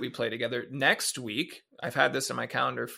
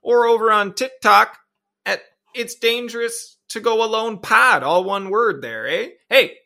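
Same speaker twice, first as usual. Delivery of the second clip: higher, louder Canadian hockey guy voice; loud Canadian rink trash talk; Shoresy-style hockey chirp; 6 semitones higher, 8 dB louder than usual. Pretend. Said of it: "That one's straight from Shoresy".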